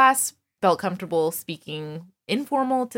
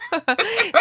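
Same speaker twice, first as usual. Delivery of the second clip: laugh; 12 semitones higher than usual. Real, not acted. Excited about clip.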